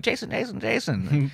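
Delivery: put-on high sing-song Irish accent call. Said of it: "with this Irish accent".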